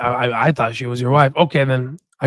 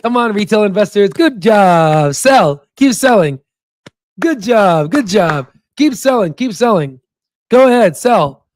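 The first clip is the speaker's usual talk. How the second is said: sarcastic egging-on, high starts falling away; high, falling mock cheers; mocking encouragement; 4 semitones higher than usual. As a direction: taunt them with fake praise. Mock-cheers retail investors for selling.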